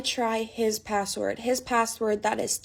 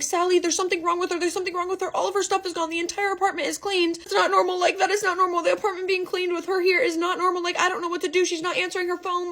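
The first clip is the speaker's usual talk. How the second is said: much higher frantic pleading, without real sobs; panicked; 8 semitones higher than usual. Retold or imitated. Begging her boyfriend to help find her missing friend, panicked. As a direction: blurt it out like your friend has vanished. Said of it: "I'm sobbing. I'm crying".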